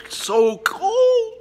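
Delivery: very high slow laughing squeal; pained laughing squeal. Real, not acted. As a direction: squeak through the burn. Ice after hot pepper.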